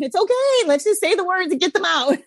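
lifted, soothing reassurance; high reassuring voice. Retold or imitated. Coach reassuring a parent, high and soothing. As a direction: coax them gently to say it. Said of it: "And I'm like".

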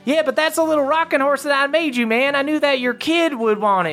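high, fast squeaky elf; high-pitched eager elf gift-giver. Acted. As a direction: rattle it off high and proud. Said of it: "walks over to that Petrie and goes".

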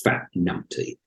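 quiet, slow, almost whispered jibe; mock insult. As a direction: breathe the insult softly like a tease.

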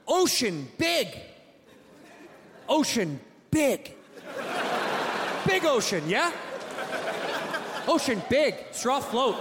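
high, slow caveman grunting "Ocean big"; caveman speech. Pretend. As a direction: talk like a dumb caveman, words chopped to basics. Caveman-speak mock reasoning.

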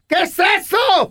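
high, slow shocked shout; startled Chayo. Retold or imitated. Chayo walks in on him, high startled yell. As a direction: shout it, caught off guard.

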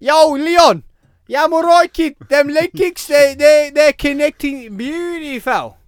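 put-on high, loud broad Birmingham accent. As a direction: ham up a thick Birmingham accent. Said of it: "why does our Birmingham accent sound really bad on T V?".

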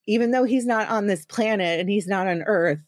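ordinary talk, nothing marked

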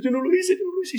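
put-on high-pitched boy protesting his innocence; frightened boy. Shaken pupil denies painting it to his master, in a high boy's voice. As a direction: squeak out a child's denial. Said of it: "Y el niño dice".

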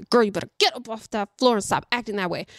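fast warning through clenched teeth; quick, tight-jawed mom threat; talking through teeth. Retold or imitated. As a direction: rattle it off under your teeth, yell held back. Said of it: "as you talk under your teeth".

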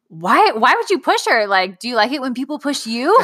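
put-on sharp, high-pitched questioning of a child; a mom losing patience over a push; exasperated scolding. Mom scolding her son for pushing his sister, exasperated. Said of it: "I'm like".

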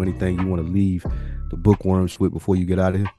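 ordinary delivery

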